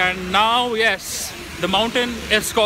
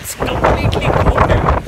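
excited shout; 8 semitones higher, 8 dB louder than usual. Real, not acted. Excited in cold rain.

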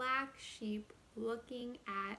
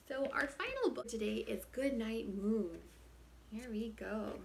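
ordinary delivery

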